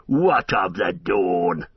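slow mocking orc jeer. Orcs jeer at Aragorn's dawn, slow mockery. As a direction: drawl it out with contempt. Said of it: "they jeered".

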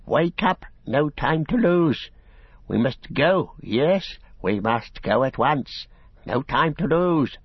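high, eerie creature voice, wheedling; Gollum whispering. A narrator's reading. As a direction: wake them with a sly, high rasp. Gollum urging hobbits to leave.

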